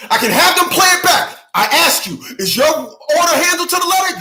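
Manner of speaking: high, loud mock lawyer grilling; hot-seat interrogation. Acted lawyer grilling a colonel, hammed-up pressing. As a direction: press the witness hard, pitched right up. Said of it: "getting him on the hot seat".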